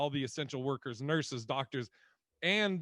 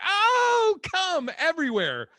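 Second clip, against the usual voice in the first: much higher, loud, swooning cry; swooning shout; 11 dB louder, slower than usual. Retold or imitated. Mock-swooning over the movie pickup line with a loud high shout. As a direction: squeal like the line just melted you. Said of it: "And I was like".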